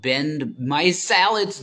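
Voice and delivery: each syllable of Ben Meiselas spaced out clearly; slow, careful name. Shown as a demonstration. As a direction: say the name slowly for the listener who asked. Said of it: "His name is what?".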